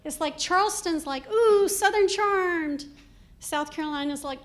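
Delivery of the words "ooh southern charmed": high, loud, drawn-out coo; high-end Southern. Acted. Charleston showing off its charm, high-end Southern gush. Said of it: "Charleston is, like, high-end redneck".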